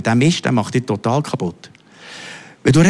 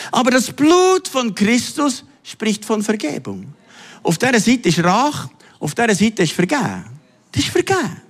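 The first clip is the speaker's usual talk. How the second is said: preaching at full force; 7 semitones higher than usual. Acted. Preacher to congregation, fervent preaching voice. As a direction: lift each phrase up toward the crowd.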